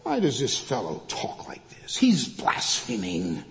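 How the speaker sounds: hushed, voiceless whisper of disapproval; scandalized whisper. Acted. Teachers of the law muttering about Jesus, scandalized whisper. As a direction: whisper it like outraged onlookers, drawing out the accusation. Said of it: "the teachers of the law in verse seven said".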